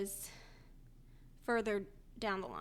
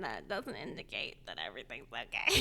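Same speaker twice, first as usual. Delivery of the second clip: deadpan; 12 semitones lower than usual. Retold or imitated. Retold dry retort to nurse.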